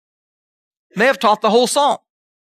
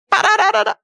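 high da-da-da fanfare; sung fanfare; 12 semitones higher than usual. Pretend. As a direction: trumpet it out like a fanfare. Fanfare sound effect.

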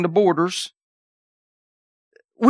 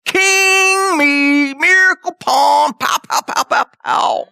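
triumphant call; 6 semitones higher, much slower than usual. Acted. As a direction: crow it out as God winning. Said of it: "He's about to go".

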